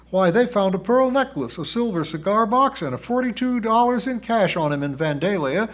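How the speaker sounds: put-on high, loud, indignant girl's voice; high-pitched cocky bragging; feisty spirit girl, boasting.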